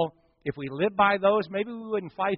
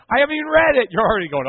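high, loud, laughing protest; 6 semitones higher, 8 dB louder, faster than usual. Real, not acted. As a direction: laugh it out to the congregation. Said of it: "You already said".

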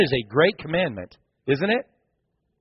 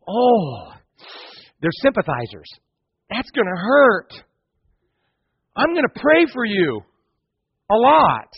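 higher, pitying mock tone; sympathizer voice; 3 semitones higher than usual. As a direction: lay on the pity. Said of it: "they go".

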